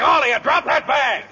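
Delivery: commanding shout. Acted. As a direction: command the whole room urgently. Chief takes control of the scuffle.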